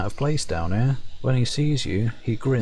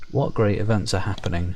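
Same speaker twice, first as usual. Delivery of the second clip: normal talking voice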